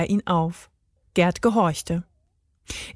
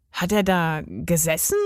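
schnippisch; 2 semitones lower than usual. A narrator's reading. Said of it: "fragte Emil schnippisch".

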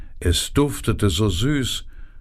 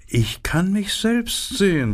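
much higher, preening vain flower voice; vain narcissus; 10 semitones higher than usual. A narrator's reading. The narcissus preening over itself, high and vain. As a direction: lift it up high, admiring yourself. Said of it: "sagte die Narzisse".

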